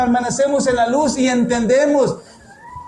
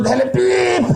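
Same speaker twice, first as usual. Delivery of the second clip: a quick high mock horn beep; car horn beep; 8 semitones higher than usual. Imitating a driver honking in traffic, high beep. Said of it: "a veces dan con un".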